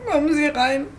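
usual speaking voice

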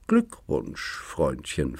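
soft, sly hush into the ear; hint of a sneering little devil; mock whisper. Devil on his shoulder whispers to Schmidt, softly mocking. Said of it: "flüsterte er Schmidt ins Ohr".

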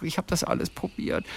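whispered mock lament. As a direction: breathe it out, defeated. Nacherzählte eigene Klage, geflüstert. (geflüsterte Klage)

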